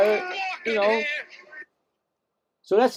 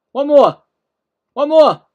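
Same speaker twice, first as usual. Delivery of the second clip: urgent plea; 4 semitones higher, 8 dB louder than usual. Real, not acted. Begging for another replay angle.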